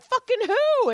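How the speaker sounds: high-pitched retold outburst of disbelief; incredulous demand. Acting out how she quizzed her patient about the comedian, incredulous.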